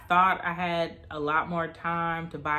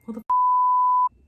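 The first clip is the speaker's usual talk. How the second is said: startled outburst; 9 dB louder, slower than usual. Real, not acted. Sudden outburst interrupting herself.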